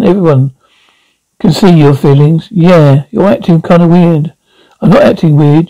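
ordinary talk

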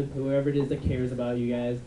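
ordinary delivery

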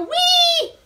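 high gleeful whee; playful whee. Real, not acted. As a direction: squeal as you throw. Throwing the ball in play.

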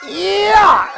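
high, loud yell; exuberant leap shout. Pretend. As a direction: yell it as you jump out. Pop springt weer omhoog. (uitgelaten sprongkreet)

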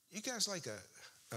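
a hint of a sweet old aunt offering fruit; elderly aunt. Aunt offers nephews fruit, lightly voiced old lady.

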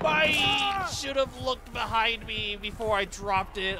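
high gasp then slow dismayed line; startled. Real, not acted. Gamer realizing the killer is right behind him, startled. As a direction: caught off guard, gasp.

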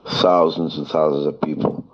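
ordinary talk

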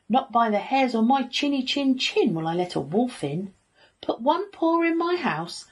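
high, loud defiant pig; rude shouting. A narrator's reading. Rude little pig refusing the wolf entry, shouted defiance. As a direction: bellow a refusal in a high pitch. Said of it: "shouted the pig rudely".

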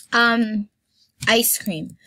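ordinary talk, nothing marked

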